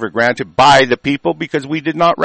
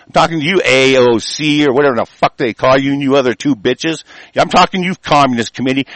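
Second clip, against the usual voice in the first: usual speaking voice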